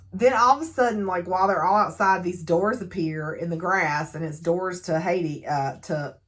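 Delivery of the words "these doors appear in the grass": usual speaking voice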